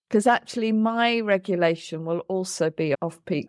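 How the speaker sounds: normal talking voice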